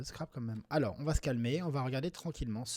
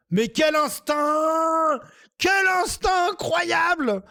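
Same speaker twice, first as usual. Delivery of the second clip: hammed-up self-praise, much higher and louder, slow; mock triumphant shout; 14 dB louder, much slower than usual. Scrabble player shouting mock praise at his own find. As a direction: crow over your own genius, tongue in cheek.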